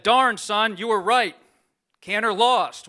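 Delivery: hint of a Texas newsman's accent, not fully done; Bob Schieffer's Texan drawl. Imitating a mentor's phone call to a young reporter, mild Texan accent. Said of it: "I can't really do his Texan accent".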